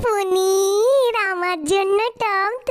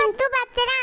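ordinary talk, nothing marked

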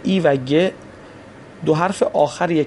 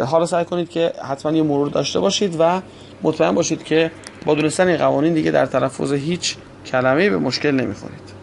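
ordinary talk, nothing marked

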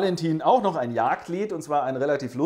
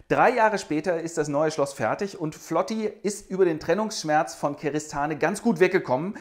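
ordinary talk, nothing marked

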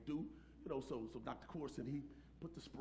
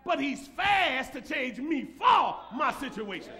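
loud, slow, ringing preacher's shout; full-force preaching; 10 semitones higher, 16 dB louder, slower than usual. Acted. Pastor shouting his faith to the congregation, full-force preaching. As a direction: preach it to the rafters.

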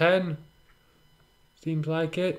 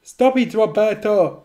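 high, urgent shout; crying out; 5 semitones higher, slower than usual. Acted. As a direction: call out to stop a friend. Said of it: "cried Cornelia".

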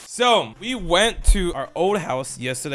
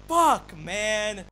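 usual speaking voice

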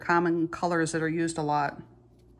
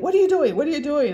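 higher, louder, alarmed repeated question; hammed-up alarm, pitched way up; mock outraged teacher; 8 semitones higher, 8 dB louder, faster than usual. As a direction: play the horrified teacher catching her. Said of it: "I thought he was gonna come unglued".

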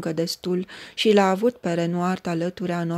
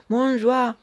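high, loud war cry; a narrated rallying shout; battle cry; 6 semitones higher, 4 dB louder than usual. A narrator's reading. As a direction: ring it out over the field. War cry heard everywhere.

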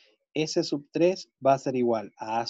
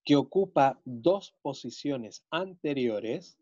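dictating; slower than usual. Shown as a demonstration. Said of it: "teacher dictating to class".

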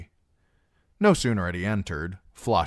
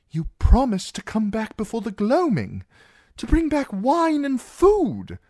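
very high, fretful scolding; loud, squeaky-high worried protest; anxious Sam, appalled; 6 dB louder than usual. Acted. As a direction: scold a friend in horrified disbelief. Plays Sam scolding Darion.

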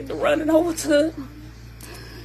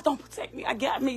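breathy whispered warning; 3 semitones lower, 12 dB quieter than usual. Retold or imitated. Mother whispering to her son not to protect her. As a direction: hiss it under your breath so only he hears. Telling Josh to back off.

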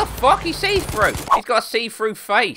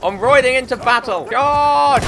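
high rallying call building louder; hammed-up cavalry shout, pitched up; mock battle cry; 7 semitones higher, 4 dB louder than usual. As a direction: holler a playful charge into battle.

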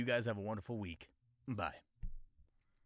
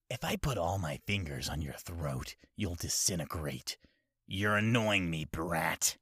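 low, loud menacing villain; cold anime villain; 6 semitones lower, 4 dB louder than usual. Retold or imitated. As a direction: threaten like a cold tyrant. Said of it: "anime impression video".

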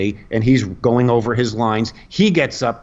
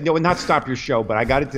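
usual speaking voice

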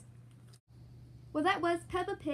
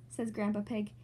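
ordinary delivery

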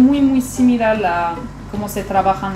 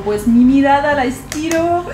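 higher-pitched self-parody of explaining makeup; mock tutorial explanation; 6 semitones higher, 4 dB louder than usual. Makeup vlogger mimics herself fumbling a tutorial, mock explaining. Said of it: "Yo ahí para explicar un maquillaje digo".

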